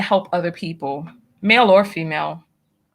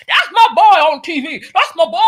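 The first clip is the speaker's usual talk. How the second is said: loud, higher mock bragging father; hammed-up proud father, louder and higher; proud dad bragging; 6 semitones higher, 6 dB louder than usual. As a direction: crow it like a dad showing off his son. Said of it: "they're like".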